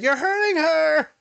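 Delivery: high, drawn-out mock outcry; mock accusing wail. Mock scolding voice in retold heckle.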